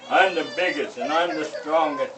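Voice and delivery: loud bragging bear; boastful bear. Acted. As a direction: boom out the boast like a big bear.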